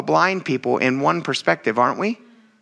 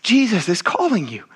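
higher, pumped-up urging; eager encouragement; 5 semitones higher than usual. Retold or imitated. Believer urging the blind men toward Jesus, eager. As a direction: hype up a friend. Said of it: "would have been like".

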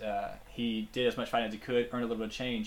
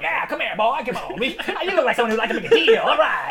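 high, loud huckster pitch; loud carnival barker; 11 semitones higher, 11 dB louder than usual. Retold or imitated. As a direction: reel him in like a sideshow barker. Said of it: "talks like a carnival barker type of thing".

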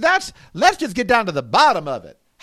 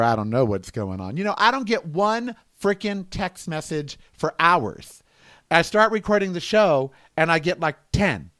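normal talking voice

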